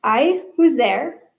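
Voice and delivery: lightly voiced Irish-ish accent; a hint of an Irish-ish lilt, wary. Cook calls out into the dark, light Irish-ish accent. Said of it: "Her thick accent from across the salt sea".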